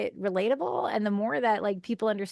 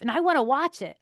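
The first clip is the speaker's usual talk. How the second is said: usual speaking voice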